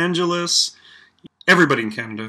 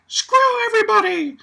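much higher, loud mock yell; mock outburst; 4 dB louder than usual. Imagining hockey player Avery defying everyone, yelling. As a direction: shriek it like a player losing it. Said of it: "If Avery was like".

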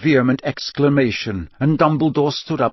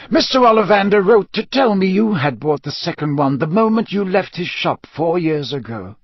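a hint of an old headmaster explaining; higher, emphatic explanation; lightly voiced Dumbledore; 6 semitones higher than usual. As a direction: explain it with measured authority.

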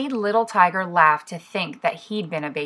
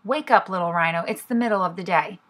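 ordinary delivery, nothing marked

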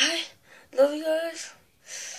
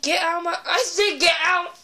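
louder repeated order; angry yell; 5 semitones higher, 5 dB louder than usual. Real, not acted. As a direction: insist loudly, annoyed. Yelling at balloon.

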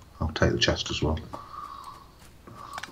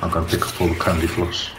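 ordinary talk

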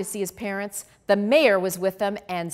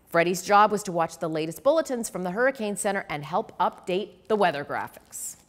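plain reading voice

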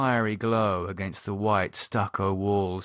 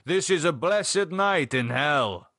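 put-on old Spanish man; 6 semitones higher than usual. A narrator's reading.

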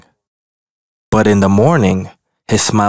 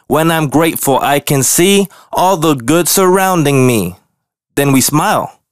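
higher, bright singsong chant; cheerful skunk; 5 semitones higher than usual. Acted. Skunk teaching grumpy bear his cheering chant. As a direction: recite it like a happy rhyme.

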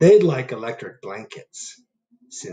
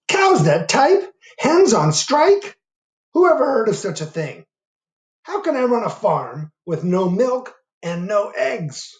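furious farmer; 10 semitones higher, 5 dB louder than usual. Acted. Farmer Brown fuming about cows and hens on strike. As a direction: rant in outrage. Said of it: "Farmer Brown was furious".

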